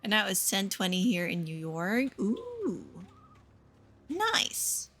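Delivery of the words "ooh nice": much higher squeaky ooh and nice; a tiny cartoonish voice of approval; squeaky delight. Real, not acted.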